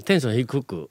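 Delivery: low energy. Shown as a demonstration. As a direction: say it low-key.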